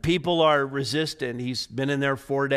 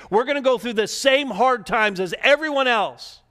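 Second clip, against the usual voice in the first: fervent preaching; 8 semitones higher than usual. Acted. Preacher presses the congregation.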